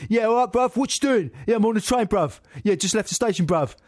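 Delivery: high, loud cockney geezer on the phone; Danny Dyer type spiv. Retold or imitated. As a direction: play a chirpy city spiv chatting to his bruv. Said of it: "a Danny Dyer type city spiv".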